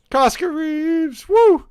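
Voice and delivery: high, slow name then a loud whoop; excited cheer. Real, not acted. Cheering Koska Reeves on screen, loud whoop. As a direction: hype the character's entrance. Fan cheers character appearance.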